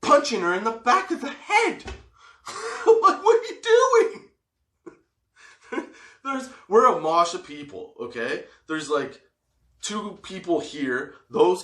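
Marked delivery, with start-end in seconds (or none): 0.0-5.9 very high, laughing through the words. Real, not acted. Storyteller recalling the punch, laughing hard and high. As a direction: breaks up laughing mid-story. Laughing retelling absurd attack.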